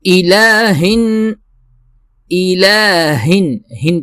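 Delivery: slow, high chanted الہن; Quran recitation. Shown as a demonstration. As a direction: recite it drawn out and melodic. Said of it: "ہا، ایچ کی". (بلند، دھیمی تلاوت کی آواز)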